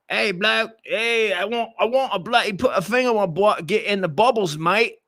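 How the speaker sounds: high-pitched, hammed-up British bloke; mock British accent. Host imagining a British guy talking nonsense, mock British accent. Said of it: "still has a British accent".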